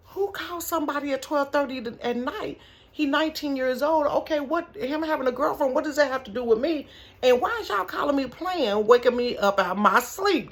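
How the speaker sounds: high-pitched, put-out grumbling; mildly exasperated, high-voiced questioning; indignant complaint. Mother woken at twelve thirty by a silly call, indignant.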